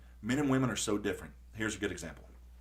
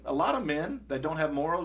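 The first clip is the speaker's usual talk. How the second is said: normal talking voice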